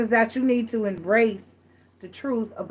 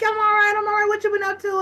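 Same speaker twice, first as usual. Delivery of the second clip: high, cheery mock voice of a guy greeting a friend; friendly greeting; 12 semitones higher, faster than usual. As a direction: greet your buddy in a high, bright put-on voice. Friend asking about life.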